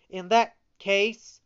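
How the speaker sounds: loud, high declaration; heroic shout. A narrator's reading. Pinocchio rising to defy the showman, loud and heroic. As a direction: cry out like a hero. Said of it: "shouted in a loud, heroic voice".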